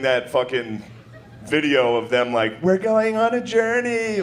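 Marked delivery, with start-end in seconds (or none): 2.6-4.2 slow, sing-song vlogger voice; imitating a cheesy travel vlog intro; a hammed-up journey announcement; mock YouTube couple. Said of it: "like that YouTube video".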